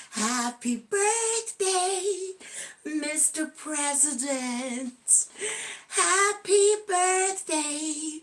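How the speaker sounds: serenade the President like Marilyn; Marilyn Monroe. Pretend. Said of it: "the Marilyn Monroe way".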